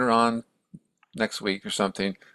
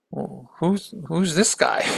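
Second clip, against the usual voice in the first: higher-pitched, intrigued question; curious question; 6 semitones higher, slower than usual. Retold or imitated. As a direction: act out puzzled curiosity, pitch lifting. Retold Buddha curious about the sadhu.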